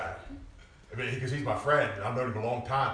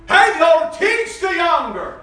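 much higher, louder slow shout; 17 dB louder, slower than usual. Real, not acted. Preacher shouts the scripture at older women. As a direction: call it out as scripture.

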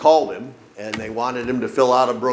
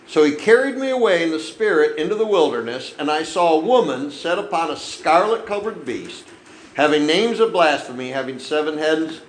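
raised, declaiming Bible reading; preacher reading Revelation aloud, raised and declaiming; scripture reading; 4 semitones higher than usual. Acted. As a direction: proclaim the verses to the congregation.

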